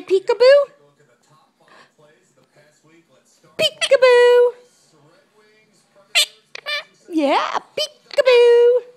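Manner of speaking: high, loud, stretched sing-song; excited peekaboo. Real, not acted. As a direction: call out peekaboo, delighted and slow. Playing peekaboo with the pet.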